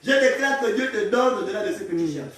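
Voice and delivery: loud, high declaration of blessing; forceful preaching. Real, not acted. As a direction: proclaim it boldly to the congregation.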